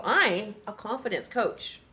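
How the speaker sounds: "I'm a confidence coach." slow, falling and firm; confident statement. Shown as a demonstration. Co-host showing the confident version, slow falling. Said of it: "You gotta be confident".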